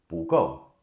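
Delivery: 不够 as bú gòu, rise then fall; 不够 with bu rising, gou falling; correct tones. Shown as a demonstration. Teacher leading repetition of the correct 不够. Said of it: "repeat after me".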